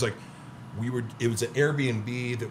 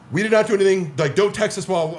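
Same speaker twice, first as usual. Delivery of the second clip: raised, louder defensive snap; higher, louder retold outburst; mock angry reply; 7 semitones higher, 6 dB louder than usual. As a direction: throw it back like an offended host. Quote of hostile Airbnb hosts.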